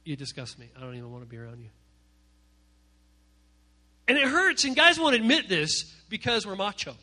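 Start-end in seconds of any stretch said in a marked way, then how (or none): 0.0-1.7 low, soft, icy cutting words; cold quiet insult. Retold or imitated. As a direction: say it quietly, to wound. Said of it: "change the way they say it".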